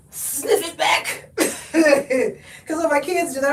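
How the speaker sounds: normal talking voice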